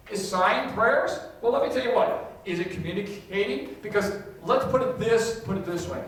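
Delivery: normal talking voice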